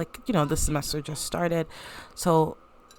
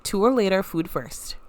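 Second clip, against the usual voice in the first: higher and bright with welcome; eager hostess; 5 semitones higher than usual. Acted. Hostess ushering guests inside, eager and higher. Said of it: "She's like".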